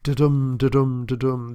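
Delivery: da-DUM, the second beat stressed each time; iambic beat. Shown as a demonstration. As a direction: tap out da-dum, da-dum, da-dum, unstressed then stressed. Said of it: "it's mostly iambic".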